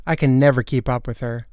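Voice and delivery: usual speaking voice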